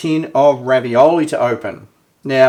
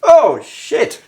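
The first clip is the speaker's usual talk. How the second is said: high, loud shocked curse; startled curse; 9 semitones higher, 6 dB louder than usual. Real, not acted. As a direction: caught off guard by a spray. Can bursting open.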